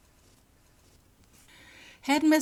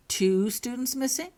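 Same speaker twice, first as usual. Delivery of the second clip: wondering; 8 semitones higher, 4 dB louder than usual. A narrator's reading. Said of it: "said McGonagall wonderingly".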